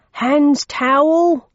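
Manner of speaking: slow, high, loud bark of an order; drawn-out commanding shout; commanding yell. A narrator's reading. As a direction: bellow it like a sergeant taking charge. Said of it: "in his most commanding voice".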